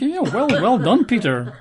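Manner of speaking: mock congratulations. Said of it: "So we might think".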